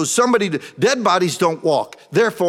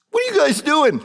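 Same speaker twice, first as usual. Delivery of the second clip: startled shout; 11 semitones higher than usual. Acted. Mary confronting angels in tomb.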